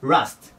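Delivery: "ラスト" with a slightly long ラ; slightly stretched vowel. Shown as a demonstration. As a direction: draw out the ラ in "ラスト" a little. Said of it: "ちょっと伸ばすんです". (「ラスト」のラをやや長めに)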